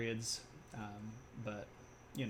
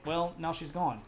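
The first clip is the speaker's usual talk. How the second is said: ordinary delivery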